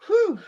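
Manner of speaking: big high exhale of relief; releasing emotion after the reading; loud high whew. Real, not acted. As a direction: blow out the tension loudly.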